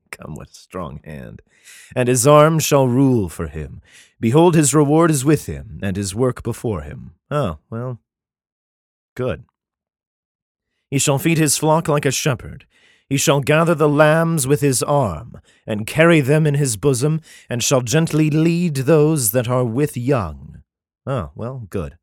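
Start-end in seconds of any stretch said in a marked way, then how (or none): 1.9-7.1 solemn, measured Bible reading; declaiming scripture, slowing at the end. Acted. Reads Isaiah aloud. 10.9-20.6 solemn, measured Bible reading; declaiming scripture, slowing at the end. Acted. Reads Isaiah aloud.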